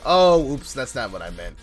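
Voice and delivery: ordinary talk, nothing marked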